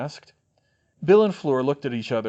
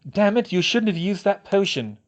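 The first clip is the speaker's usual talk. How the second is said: higher-voiced angry reproach; growled anger; 7 semitones higher, 4 dB louder than usual. A narrator's reading. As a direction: scold her for using that potion. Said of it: "Bill growled".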